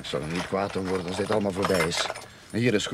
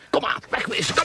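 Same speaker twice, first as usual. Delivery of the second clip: whispered get out; hushed call to flee the cemetery; urgent whisper; 13 semitones higher, 4 dB louder than usual. Acted. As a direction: hurry off under your breath. Fleeing the graveyard.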